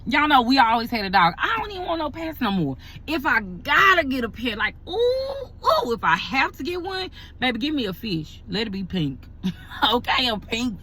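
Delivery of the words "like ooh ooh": mock wail. Real, not acted. Dreading getting a pet.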